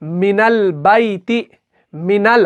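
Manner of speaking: मिनल बैती read slowly, नून joined to लाम. Shown as a demonstration. Teacher demonstrating मिनल बैती to students. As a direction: stress मिनल with the alif silent. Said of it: "जब हम इसको पढ़ते हैं".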